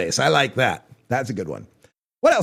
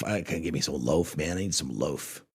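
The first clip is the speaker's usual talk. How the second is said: mock stoner voice; 12 semitones lower, 9 dB quieter than usual. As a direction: murmur it like a burned-out stoner. Mock stoner asking for weed.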